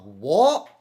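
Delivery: loud, disbelieving what; shocked what. Retold or imitated. Streamer retells hearing a fan's take, loud shocked what. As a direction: blurt it out in pure disbelief. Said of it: "I was like".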